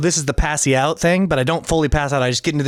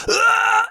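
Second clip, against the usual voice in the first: very high straining scream; Vegeta heave scream. Retold or imitated. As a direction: scream out a heave like a powering-up warrior. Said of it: "I'm going full Vegeta".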